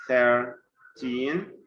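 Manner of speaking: put-on "Thirteen" split, strong THIR and rising teen; first-syllable stress. Shown as a demonstration. Teacher showing the stress, "Thirteen" with heavy THIR. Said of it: "stress will be in the first syllable".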